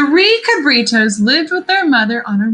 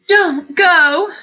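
high, louder pleading mother; 7 semitones higher, 5 dB louder than usual. A narrator's reading. Mother begging her sons not to cross, pleading. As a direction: beg them to stay home. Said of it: "their mother pleaded".

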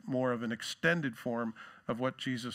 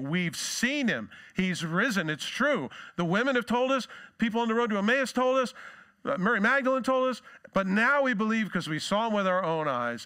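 higher, louder, tumbling with excitement; eager, overlapping news shouted out; excited disciples; 9 semitones higher, 6 dB louder than usual. Acted. Disciples telling Thomas they saw him, excited and loud.

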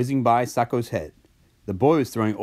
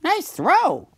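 very high cartoon yell; yelled cheer; 4 dB louder than usual. A narrator's reading. Slurpee cheers the boy's throw, high yell. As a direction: shout praise in a high character voice. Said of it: "Slurpee yelled".

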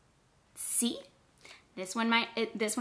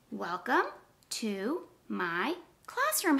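slow, one word at a time; slower than usual. Shown as a demonstration. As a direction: count each word out slowly. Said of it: "count the words".

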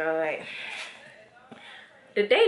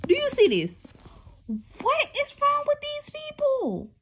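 outraged disbelief. Real, not acted. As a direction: shriek at the page in disbelief. Outraged reaction while reading.

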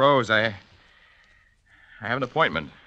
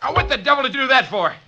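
angry protest; 13 semitones higher, faster than usual. Acted. Student hurt by the blade.

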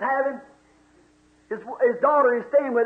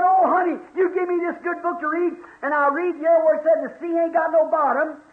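put-on excited old man; 3 semitones higher than usual. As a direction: explain it bursting with joy. Old man explaining joy to daughter.

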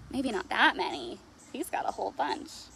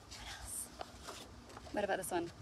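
whispered aside; 6 semitones lower, 11 dB quieter, faster than usual. Acted. Choosing colored papers.

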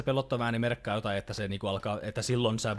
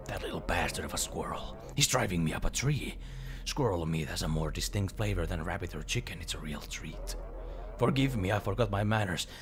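a lightly voiced lower backwoods hunter; gruff hunter Daedalus; 4 semitones lower, 3 dB quieter than usual. Daedalus the woodsman greets the journalist, lightly lower grumble.

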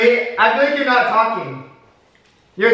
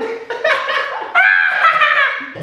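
high shrieking laughter. Real, not acted. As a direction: burst into a high squealing laugh.

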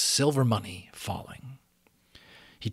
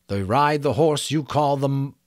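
absent-minded intoning; 4 semitones higher, 5 dB louder, slower than usual. A narrator's reading. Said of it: "he intoned absent-mindedly".

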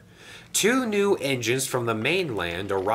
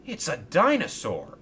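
higher, eager cry of discovery; excited exclaim; 7 semitones higher than usual. A narrator's reading. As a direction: blurt it out with excitement. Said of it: "exclaimed Nelson".

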